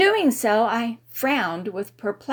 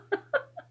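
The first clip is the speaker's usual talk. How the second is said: high squeak of laughter; 5 dB quieter than usual. A narrator's reading. Laugh ends.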